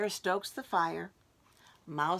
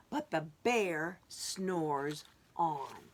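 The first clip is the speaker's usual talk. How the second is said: slow refrain; 3 semitones lower, much slower than usual. Acted. Refrain read slowly.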